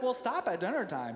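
ordinary talk, nothing marked

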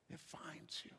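dropped to an unvoiced whisper; ominous whisper. Preacher warning that death finds everyone, whispered. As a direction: land the line in a hush. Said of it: "sermon refrain whispered".

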